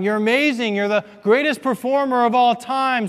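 high, loud cheering crowd; crowd shouting. Retold or imitated. Crowd at Niagara Falls shouting praise, loud and high. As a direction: cheer him on like an amazed crowd. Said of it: "they're shouting".

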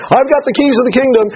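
high-pitched indignant appeal; Peter's mock protest. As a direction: protest from outside the locked gate. Acted protest from Peter outside the gate.

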